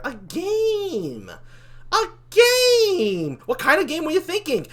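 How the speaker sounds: high, drawn-out cry of revelation; awed excitement. Acted. Demon realising the angel is onto something, awed excitement. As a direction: let it soar as the idea dawns. Suddenly taken with the idea.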